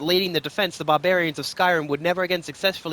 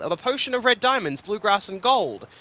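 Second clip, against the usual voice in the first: bold voice; 6 semitones higher than usual. Acted. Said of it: "said the floating voice, bold".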